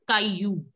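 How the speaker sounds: Caillou ending in "yoo"; students' mispronunciation. Retold or imitated. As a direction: mimic the wrong Caillou, "kai-yoo". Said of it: "all of you were pronouncing it as".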